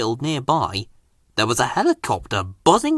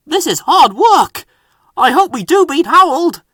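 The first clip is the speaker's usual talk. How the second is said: higher and louder, effortful; fireman working hard; 12 semitones higher, 6 dB louder than usual. Acted. Fireman shoveling coal, high and effortful. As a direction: speak between shovels.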